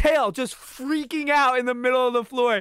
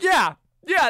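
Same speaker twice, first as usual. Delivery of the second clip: higher, louder eager agreement; excited yeah; 7 semitones higher, 5 dB louder than usual. Retold or imitated. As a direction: cheer it on like a kid. Excitedly approving the makeshift terrarium.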